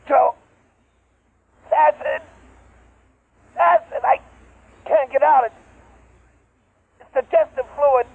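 rising terror, pitch climbing high; desperate, trapped and screaming for help; panicked cries. Acted. Trapped, being digested, calling.

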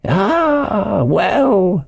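put-on high, slow old sailor's yarn; eccentric castaway, confiding. A narrator's reading. Ben Gunn's voice.

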